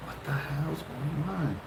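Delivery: inner thought. Retold or imitated. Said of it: "On my mind".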